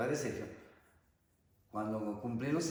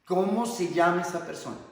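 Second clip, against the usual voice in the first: louder, higher, measured counselor's demand; insistent question; 4 semitones higher, 7 dB louder than usual. Acted. Counselor pressing someone who answered "nadie", insistent question. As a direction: press the question firmly, word by word.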